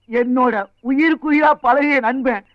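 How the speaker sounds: hammed-up weeping over a dear friend; tearful. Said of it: "அழுகையா வருது".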